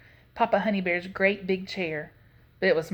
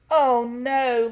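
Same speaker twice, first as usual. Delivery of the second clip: loud, higher moan of dismay; 4 semitones higher, 6 dB louder than usual. A narrator's reading. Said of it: "Leola moaned".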